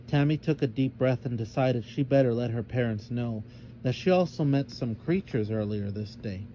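ordinary delivery, nothing marked

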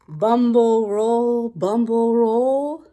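slow, drawn-out rolling words; lumbering bear. Acted. Acting out how a bear walks.

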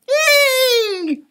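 very high, loud bird shriek. A narrator's reading. Flap shrieks while falling through the night. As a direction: shriek in terror. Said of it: "shrieked Flap".

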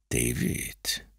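threatening stepfather whispering. Acted. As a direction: hiss his name close to the ear. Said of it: "flüsterte er mir zu".

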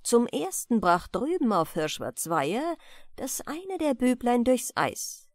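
ordinary delivery

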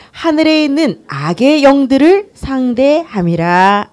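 slow, loud, measured recitation; leading scripture reading. Real, not acted. Preacher leading the congregation in reading Ephesians, measured recitation. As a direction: read the verse out for all to follow. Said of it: "우리 같이 한 목소리로 읽겠습니다".

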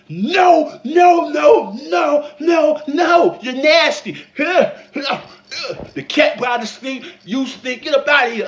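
loud, high comic refusal; hammed-up outraged no; disgusted shouting. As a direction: yell her away in disgust.

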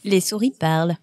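normal talking voice

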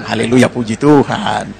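slow, low, drawn-out praise; mock pious praise. Preacher mimics Christians who only praise, slow low mock piety. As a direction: draw it out slowly in mock piety.

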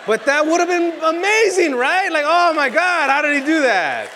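high, loud, gushing amazement; mock amazed crowd. To the audience, imagining their wonder, mock amazed squeal.

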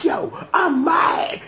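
high, slow, crying-out mock anger; mock angry Ruby. Viewer acting out Ruby's anger, high slow mock wail. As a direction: whine it furious. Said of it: "Ruby, like, she sit up here, like".

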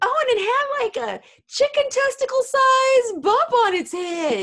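hammed-up impression of the oversharing nurse; crude farm woman. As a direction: blare it out with no shame. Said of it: "this woman is like".

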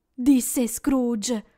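plain reading voice

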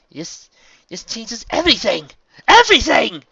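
high, loud, astonished exclamation; excited outburst. Acted. Mayor realises Twilight is alive, excited outburst. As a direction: build to a shouted, drawn-out cry.

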